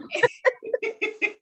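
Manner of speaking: high, loud peals of laughter; laughing. Real, not acted. Host cracking up at the catchphrase, laughing loudly.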